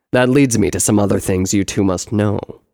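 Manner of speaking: loud, low brother's voice; deep, forceful elder-brother character; explaining brother. Acted. Brother turns to other matters.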